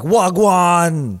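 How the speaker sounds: loud, high-pitched East London shout; East London roadman accent. Retold or imitated. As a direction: mimic a rough East London roadman yelling. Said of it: "he starts talking like that".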